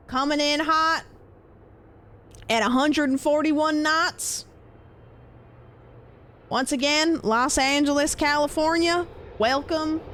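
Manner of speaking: high, projected mock announcer call; hammed-up play-by-play of a plane arriving. Streamer announcing the flight from Los Angeles, showy announcer voice.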